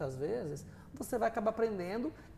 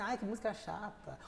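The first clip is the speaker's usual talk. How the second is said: bored student; 8 dB quieter than usual. Retold or imitated. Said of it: "falo assim".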